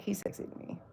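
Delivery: breathy whispered confession; soft unvoiced admission. Real, not acted. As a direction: whisper it like a guilty secret.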